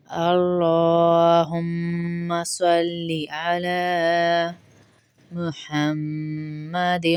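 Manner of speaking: slow, drawn-out chanted durood; slow recitation. Acted. Teacher recites durood for students to follow. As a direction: recite it reverently, stretching each word.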